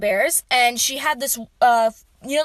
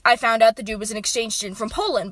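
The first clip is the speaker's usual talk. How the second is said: usual speaking voice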